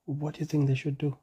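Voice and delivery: ordinary delivery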